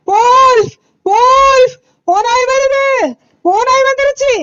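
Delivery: high, loud desperate cry for help. Acted. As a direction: scream wolf at the top of your voice, high. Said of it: "இன்னும் சத்தமா கத்துவோம்".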